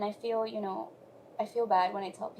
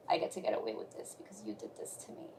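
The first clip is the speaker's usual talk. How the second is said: whispered, lower and fast, a secret grudge; 11 semitones lower, 6 dB quieter, faster than usual. Retold or imitated. Speaker whispering her old grudge against God, whispered. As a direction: hush it like a bitter secret. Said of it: "It's like".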